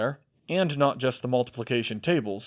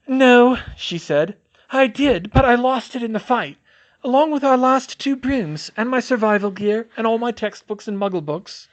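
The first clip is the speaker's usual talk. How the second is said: Hermione voice; 11 semitones higher, 8 dB louder than usual. A narrator's reading.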